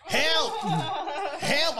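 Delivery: high mock cry for help; man down shout. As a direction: call for help, laughing. Mock call for help in smoke.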